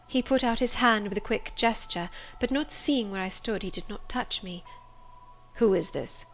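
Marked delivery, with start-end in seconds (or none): 5.5-6.2 restrained first demand. A narrator's reading. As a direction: ask it warily, under control. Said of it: "he demanded".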